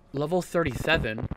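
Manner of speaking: ordinary talk, nothing marked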